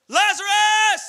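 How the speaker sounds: high, loud summons; commanding call. Acted. Jesus calling Lazarus from the tomb, loud command. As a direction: call him out of the grave.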